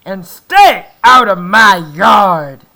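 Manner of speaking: loud, slow grumpy yell; angry yell. Acted. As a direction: shout back, offended. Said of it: "Hank yelled back".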